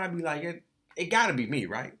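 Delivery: ordinary talk, nothing marked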